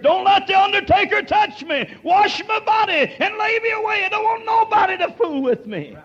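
high, loud story of the dying uncle; preaching at full force. Real, not acted.

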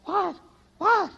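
high, sharp startled "what", acted out; startled cry. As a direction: cry out in mock alarm. Mocking husband startled, arm stuck.

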